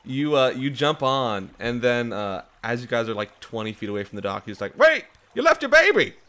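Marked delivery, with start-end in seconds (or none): 4.8-6.2 high, loud frantic call; shouting after them. Acted. NPC calling after departing party.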